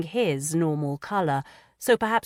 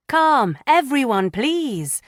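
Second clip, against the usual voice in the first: calling for calm; 8 semitones higher, 6 dB louder, slower than usual. A narrator's reading. Anne calls the room to order.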